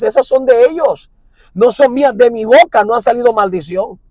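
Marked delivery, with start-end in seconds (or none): none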